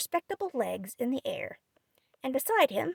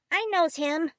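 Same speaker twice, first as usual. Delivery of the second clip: put-on high, eager small child; lightly voiced small boy; little boy voice; 6 semitones higher, 5 dB louder than usual.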